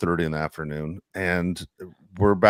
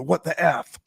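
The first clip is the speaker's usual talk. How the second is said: mock yelled curse; 10 semitones higher, slower than usual. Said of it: "do the".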